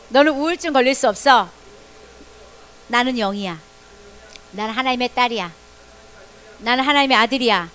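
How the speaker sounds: loud, firm declaration. Shown as a demonstration. Children answer depression with a loud declaration. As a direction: command the depression to leave, boldly. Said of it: "빨리 해보세요".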